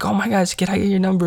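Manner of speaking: high-pitched gushing fan; high, giddy request; excited. Retold or imitated. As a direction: imitate someone excitedly asking, pitch way up. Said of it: "then been excited".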